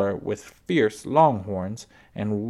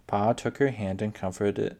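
plain reading voice

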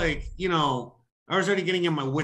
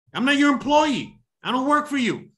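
indignant retort; 9 semitones higher, 3 dB louder than usual. Retold or imitated. His own indignant reply retold.

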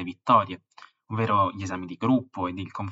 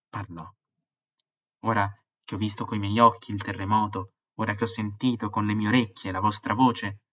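a storyteller bringing a disaster to life; dramatic story reading; 4 dB louder than usual. A narrator's reading. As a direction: read the excerpt with rising drama. Dramatic reading of book excerpt.

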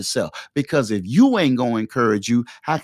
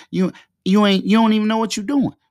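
higher, louder jeering taunt; inner Satan voice mocking; 9 semitones higher, 4 dB louder, faster than usual. The inner Satan voice scoffing at the podcast plan, higher and louder. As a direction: rise up and jeer, fast and loud on the last words. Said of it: "It talks to you like this".

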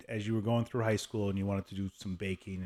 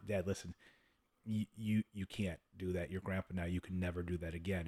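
low-key, softer serious talking-to; quiet admonishing; 3 semitones lower, 7 dB quieter than usual. Retold or imitated. Son correcting his dad about grandparenting, quiet admonishing. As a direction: take him aside and set him straight softly.

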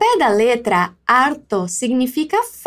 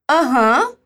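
ironic aham. Retold or imitated.

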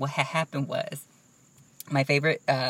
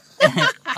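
loud laugh; 12 dB louder than usual. Real, not acted. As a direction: burst of loud high laughter. Teasing friend about old car.

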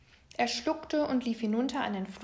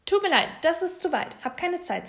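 fast, higher, louder brush-off; hurried; 7 semitones higher, 7 dB louder than usual. A narrator's reading. Agitated Frau Eichhorn refusing the little bear, hurried. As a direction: rush it out, no time to stop.

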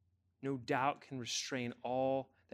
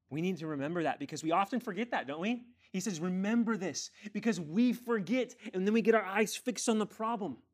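high, loud, urgent sermon delivery; impassioned preaching; 8 semitones higher, 6 dB louder than usual. Real, not acted. Preacher warning church about forgetting, impassioned. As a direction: press the warning home, raised and insistent.

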